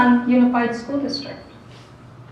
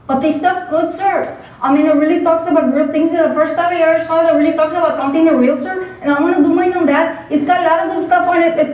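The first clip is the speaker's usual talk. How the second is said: higher, louder and fast, eager student; pleading student Beto; 6 semitones higher, 4 dB louder, faster than usual. Acted. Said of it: "a student called Beto".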